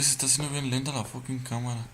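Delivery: normal talking voice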